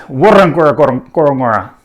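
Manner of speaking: "warangora korong-, korongora" with a loud first syllable, lighter beats after; main and secondary stress. Shown as a demonstration. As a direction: rattle off "warangora korong-, korongora" fast, punching the opening syllable. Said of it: "main stress on the first, and then secondary stress".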